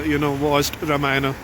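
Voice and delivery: usual speaking voice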